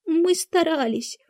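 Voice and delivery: high, slow, meek excuse; timid. A narrator's reading. Two excuses himself to the Queen, timidly. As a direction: plead meekly, high and drawn out. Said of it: "робко проговорил Двойка".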